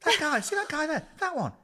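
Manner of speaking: hammed-up friend gasping over a stranger; imitating Kate. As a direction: squeal it like tipsy Kate pointing him out. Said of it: "She goes".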